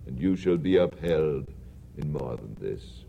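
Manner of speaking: soft, low ghostly voice; a calm, distant spirit. Acted.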